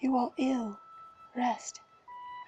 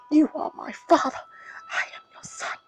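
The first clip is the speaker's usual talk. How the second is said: broken, breathy begging in tears; tearful pleading whisper; 5 semitones higher than usual. Acted. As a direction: plead with the dead in a crying whisper. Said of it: "he sobs and sobs".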